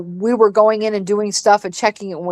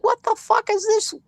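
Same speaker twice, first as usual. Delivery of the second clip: exasperated; 14 semitones higher, 4 dB quieter than usual. Retold or imitated. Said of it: "I'm like".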